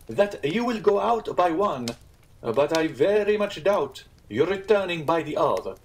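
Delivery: high, measured, emphatic warning; warning Italian innkeeper. Acted. Innkeeper doubting the guests will return, ominously emphatic. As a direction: lift the voice and weigh each phrase.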